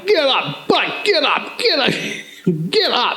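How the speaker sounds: high-pitched imitation of a wake-up yell; mock yelling. Instructors waking dozing recruits, mock yelling. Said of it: "they come and they're yelling".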